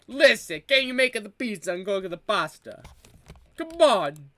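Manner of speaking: insistent hammy Italian plea; mock Italian accent. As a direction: urge them in a put-on Italian accent.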